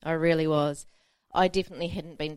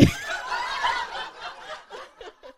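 very high, fading squeal of laughter; 4 dB quieter than usual. Real, not acted. Laughing at her own joke.

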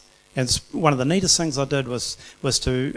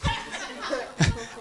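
high laugh; 11 semitones higher, 7 dB quieter than usual. Real, not acted. Laugh after marching joke.

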